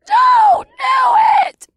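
huge drawn-out shouted warning; very loud, high, stretched-out wail; terrifying cry. A narrator's reading. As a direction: cry it out slowly like a menacing spirit. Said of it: "A deep and terrifying voice cried out".

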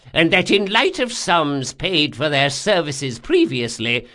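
put-on high, loud old man's recital; formal old man. Old man repeats bondsmage's words under threat, high voice.

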